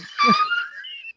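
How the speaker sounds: big burst of laughter; laughing. Real, not acted. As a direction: crack up laughing. Bursts laughing at own story.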